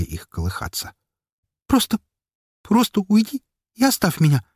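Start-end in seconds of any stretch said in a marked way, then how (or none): 1.7-4.4 pleading. Acted. Girl begs on window ledge.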